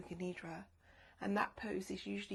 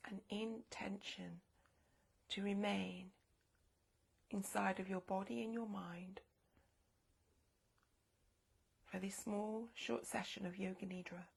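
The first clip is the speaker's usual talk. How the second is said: guided meditation. Acted. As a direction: guide them gently inward. Leads a yoga nidra meditation.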